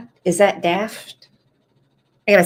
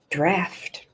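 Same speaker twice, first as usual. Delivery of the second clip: put-on Florida accent; 7 dB quieter than usual. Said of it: "in her Florida accent".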